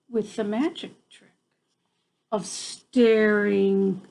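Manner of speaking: usual speaking voice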